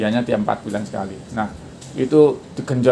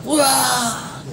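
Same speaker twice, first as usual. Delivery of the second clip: demonstrated training yell; 11 semitones higher than usual. Shown as a demonstration. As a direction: let out a high, held demo cry. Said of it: "suruh teriak". (teriakan contoh bernada tinggi)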